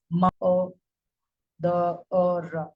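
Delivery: "ma, uh, the, uh" as separate sounds with gaps; sounded out. Shown as a demonstration. Teacher showing how mother is pronounced, sounded out. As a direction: say each phoneme apart: "ma, uh, the, uh". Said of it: "Mother is pronounced as".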